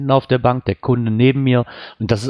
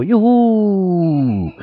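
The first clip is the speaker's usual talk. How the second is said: high, bright cheer of joy; excited cheer; 9 semitones higher than usual. Acted. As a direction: cheer it like a kid. Cheering with daughter about Nikolaus.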